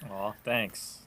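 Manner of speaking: ordinary talk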